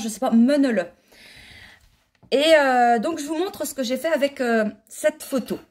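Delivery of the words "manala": "menele" said louder, with muted eu vowels; patois pronunciation. Shown as a demonstration. Knitter guessing at the patois name of the bonhomme, "menele" pronounced firmly with eu vowels. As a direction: say "menele" firmly as meu-neu-le, ending swallowed. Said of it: "certainement en patois, je pense".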